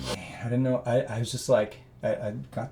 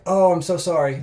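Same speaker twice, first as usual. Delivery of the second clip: barista; 7 semitones higher, 9 dB louder than usual. Retold or imitated. Barista apologizing in story.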